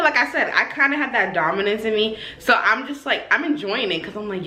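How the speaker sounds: ordinary talk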